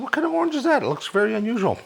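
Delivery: high-pitched retold query; curious question. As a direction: act out puzzled curiosity, pitched well up. Retells own question to store staff.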